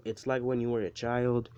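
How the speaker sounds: ordinary talk, nothing marked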